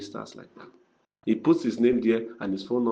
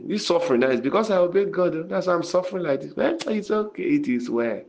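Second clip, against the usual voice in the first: higher, faster self-pitying whine; mock complaining; 7 semitones higher than usual. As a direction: whine like a suffering pastor seeking pity. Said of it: "I've always been complaining".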